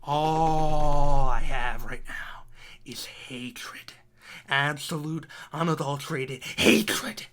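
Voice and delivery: slow, low, gritted whisper of hatred. Real, not acted. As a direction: let the hate seep out low and slow. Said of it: "Do you feel the anger coming out of me?".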